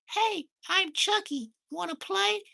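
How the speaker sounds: high, squeaky killer doll voice; creepy playful doll inviting you to play; Chucky voice. Pretend. Showing off his voice to viewers, high creepy Chucky doll. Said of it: "I got the voice".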